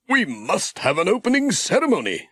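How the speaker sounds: a fussing clergyman at a loss; fretting vicar. A narrator's reading.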